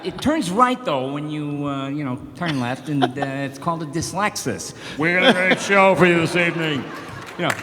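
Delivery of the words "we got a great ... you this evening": loud, hammed show host intro; Leno host voice. Imitating Jay Leno opening the show. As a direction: boom it like a late-night host. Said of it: "Tourette out all that stuff".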